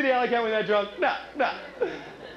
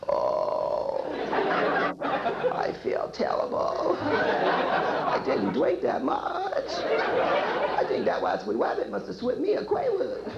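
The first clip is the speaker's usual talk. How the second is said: Elmer Fudd; much slower than usual. Pretend. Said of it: "Elmer Fudd on New Year's Day".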